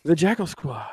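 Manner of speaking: breathy hushed whisper of the name; whispered intro. Acted. Host introducing co-hosts in a dramatic whisper. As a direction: hush it like a secret announcement. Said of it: "I don't know why I'm talking like that".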